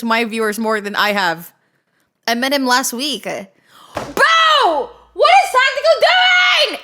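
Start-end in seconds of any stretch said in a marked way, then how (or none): 3.2-6.8 outraged shout. Real, not acted. Streamer shouting at chat about Tactical, high slow yell. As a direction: scream it across the room.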